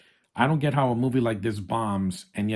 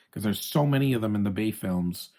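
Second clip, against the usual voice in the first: usual speaking voice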